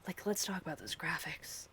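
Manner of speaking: conspiratorial soft whisper; whispered aside. Real, not acted. Joking aside to chat about the graphics, whispered. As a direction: lean in and whisper it. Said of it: "whispered aside".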